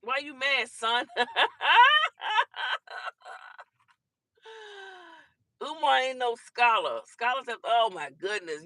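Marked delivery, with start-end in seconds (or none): 1.1-3.6 high loud burst of real laughter; big laugh. Real, not acted. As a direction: cackle at the angry viewer. Laughing at angry commenter.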